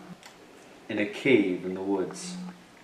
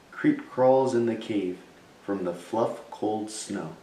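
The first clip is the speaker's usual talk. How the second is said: usual speaking voice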